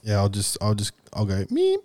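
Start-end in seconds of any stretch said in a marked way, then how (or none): 1.4-1.8 squeaky high-pitched meep; high meep buzzer. Acted. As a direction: chirp it like a buzzer. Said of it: "That's my buzzer".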